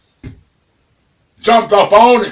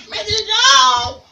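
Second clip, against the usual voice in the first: very high, slow shrieking parrot; high wailing parrot calls; parrot squawk; much slower than usual. Acted. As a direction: screech it like a crazed bird. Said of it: "you hear the bird".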